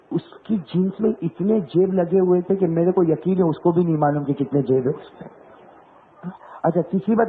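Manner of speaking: ordinary delivery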